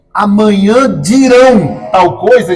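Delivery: slow, deliberate demo of the plain future; slow example. Shown as a demonstration. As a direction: say Amanhã dirão tal coisa slowly. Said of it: "ao invés de você dizer assim". (exemplo dito devagar)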